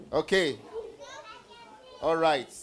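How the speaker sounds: ordinary talk, nothing marked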